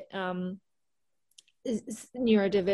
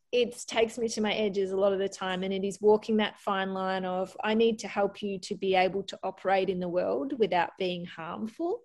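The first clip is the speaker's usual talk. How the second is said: normal talking voice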